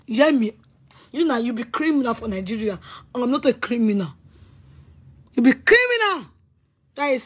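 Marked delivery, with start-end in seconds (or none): 5.3-6.3 angry accusation. Real, not acted. Accusing Victor in a rant.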